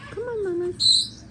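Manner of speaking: coaxing a wild bird. Real, not acted. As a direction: coo to lure the birds. Coaxing birds.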